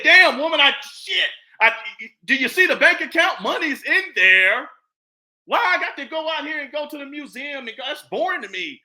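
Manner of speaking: loud, high, annoyed retort to his woman; exasperated retort. Retold or imitated. Man snapping back at a nagging woman, loud and exasperated. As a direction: throw it back at her, fed up and loud. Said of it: "I said".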